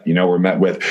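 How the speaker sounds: ordinary talk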